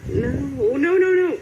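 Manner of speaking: high, loud panicked no no no; rising panic as the enemy closes in. Real, not acted. Panicking in game.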